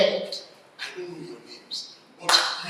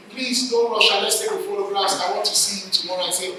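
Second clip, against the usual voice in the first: ordinary talk, nothing marked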